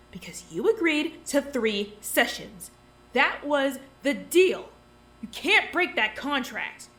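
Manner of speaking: slow, loud, hammering each word; insistent. Acted. Pressing the doctor on the contract, slow and forceful. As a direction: lean in and pin them to the deal.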